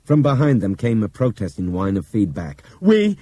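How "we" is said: high loud blast; loud shout. Acted. Bob yelling into the intercom, loud start. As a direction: bellow the first word.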